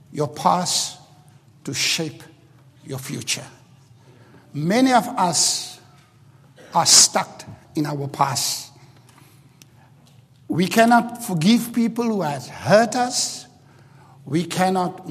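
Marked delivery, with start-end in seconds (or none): none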